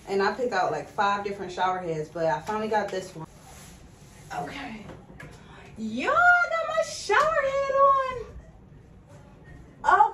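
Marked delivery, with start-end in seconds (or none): 5.8-10.1 high, thrilled squeal; excited squeal. Real, not acted. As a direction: squeal it out, delighted. Excited about new shower head.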